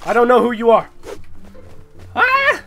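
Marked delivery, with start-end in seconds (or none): 2.1-2.6 sudden high scream of shock; startled scream. Real, not acted. A player cries out as he is suddenly shot. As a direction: yelp as you get hit out of nowhere. Killed suddenly in one hit.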